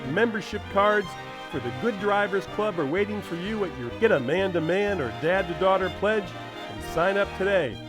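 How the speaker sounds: ordinary talk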